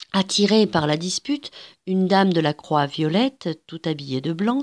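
usual speaking voice

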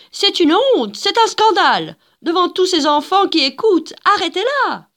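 voix aiguë et forte d'une dame outrée; dame outrée criant. Acted. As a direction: crier son indignation de grande bourgeoise. Dames outrées protestent. (dame scandalisée, voix haute et forte)

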